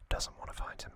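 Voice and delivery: normal talking voice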